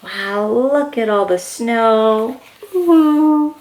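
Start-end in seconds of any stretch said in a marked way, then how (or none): none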